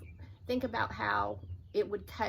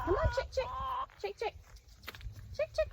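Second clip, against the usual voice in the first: high coaxing "chick, chick"; coaxing chicken call; 14 semitones higher, faster than usual. Real, not acted. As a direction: lure the hen in with a high call. Calling the chicken in.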